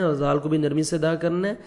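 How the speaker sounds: ordinary talk, nothing marked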